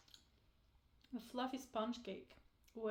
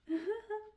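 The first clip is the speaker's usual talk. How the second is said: laugh; 10 semitones higher than usual. Real, not acted. Laughs at cute cookie.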